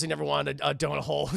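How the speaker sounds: ordinary delivery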